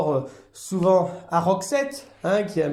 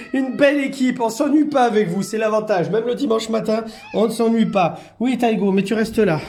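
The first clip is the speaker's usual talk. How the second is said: louder, higher, upbeat; cheerful; 6 semitones higher, 6 dB louder than usual. Real, not acted. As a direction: announce the lively bunch with glee. Cheerful remark over the noisy cats.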